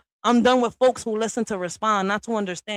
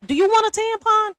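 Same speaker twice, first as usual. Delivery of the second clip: mocking jab; 12 semitones higher than usual. Real, not acted. Mocking a moody man.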